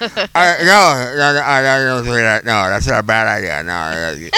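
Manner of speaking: numb mouth, gauze-muffled words; mouth full of gauze. Retold or imitated. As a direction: talk through a numb mouth. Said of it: "why are you talking like that".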